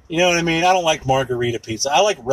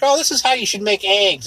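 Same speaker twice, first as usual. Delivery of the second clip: chirpy mock tutorial voice, higher; mock YouTube cook; 6 semitones higher than usual. Mocking an online cooking tutorial, chirpy voice. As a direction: parody a cooking video host. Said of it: "this thing on YouTube where it's like".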